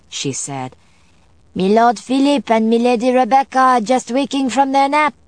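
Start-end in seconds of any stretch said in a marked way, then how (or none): 1.6-5.2 high, louder, deferential servant voice; an old-fashioned housekeeper reporting politely. A narrator's reading. Housekeeper character voice.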